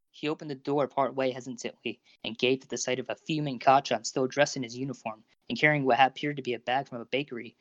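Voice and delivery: plain reading voice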